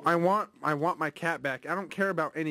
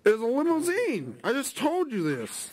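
exasperated insisting; 7 semitones higher than usual. Pretend. Prank caller insisting to the clerk.

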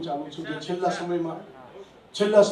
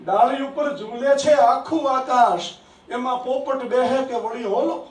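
raised, sing-song reciting voice; poetry recitation; 5 semitones higher, 7 dB louder than usual. Acted. Poet reciting his verse to an applauding audience, raised and sing-song. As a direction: declaim each line to the hall, savouring it.

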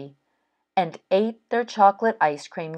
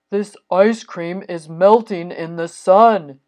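mildly alarmed Toad, louder; lightly voiced character with a touch of dismay; Toad worrying; 2 semitones higher, 5 dB louder than usual. Toad notices ice cream melting.

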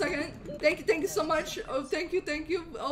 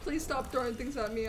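normal talking voice

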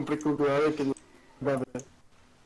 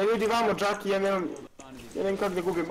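normal talking voice

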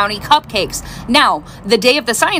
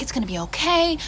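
much softer, soothing whisper-like comfort; soft reassurance; 2 semitones higher, 7 dB quieter than usual. Retold or imitated. Mom comforting her daughter during a seizure. Rubbing seizing daughter's head.

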